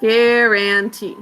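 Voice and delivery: "Guarantee" stretched slowly syllable by syllable; teacher sounding out a word for kids, slow. Shown as a demonstration. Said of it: "spelling lesson".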